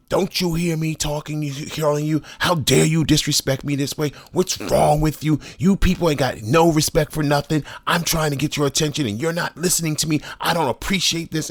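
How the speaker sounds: high, indignant mimicry of a coworker; imitating angry woman. Coworker scolding him for ignoring her, high mock tirade. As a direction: play her scolding, pitched high and huffy. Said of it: "she is yelling at me at the top of her lungs".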